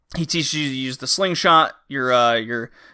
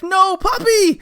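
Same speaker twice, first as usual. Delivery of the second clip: much higher, louder anguished wail; anguished cry; 5 dB louder, slower than usual. Retold or imitated. Retelling the girl finding her dog, high anguished cry. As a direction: cry out for the dying puppy. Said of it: "And you're like".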